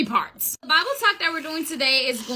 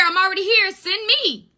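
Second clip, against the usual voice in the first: higher-pitched eager offer; eager volunteering; 6 semitones higher than usual. Acted. Prophet answering God's call, eager. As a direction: pitch it up and put yourself forward.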